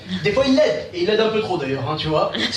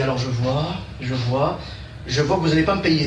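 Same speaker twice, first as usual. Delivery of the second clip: astrologue; 6 semitones lower than usual. Acted. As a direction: intone it like a seer at a crystal ball. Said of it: "une espèce de cabane d'astrologue". (voix grave de voyant)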